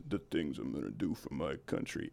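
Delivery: imitating Vin Diesel's deep line. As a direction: growl it low like a movie tough guy. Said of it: "Vin Diesel goes".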